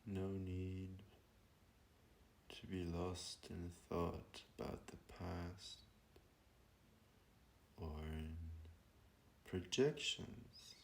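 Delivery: slow, hushed meditation voice with long pauses; guided meditation. Real, not acted. Teacher guiding listeners to breathe and be present, calm meditation voice. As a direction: ease them gently into stillness, phrase by unhurried phrase.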